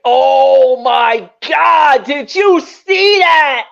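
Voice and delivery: drawn-out, rising cry of disbelief; astonished reaction. Retold or imitated. Retelling his reaction to the launch, astonished cry. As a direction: stretch it out slowly, ending high in amazement. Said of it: "I was like".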